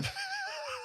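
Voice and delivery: squeaky high-pitched giggle burst; high squealing laugh. Real, not acted. Burst of laughter after joke.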